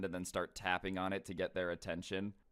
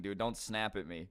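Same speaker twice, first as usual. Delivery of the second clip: ordinary delivery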